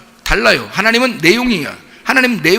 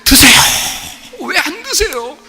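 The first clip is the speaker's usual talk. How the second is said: put-on pushy child; 9 semitones higher than usual. Child urging parent to eat.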